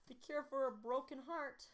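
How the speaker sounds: high put-on British woman; British-accented mother. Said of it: "keeping British".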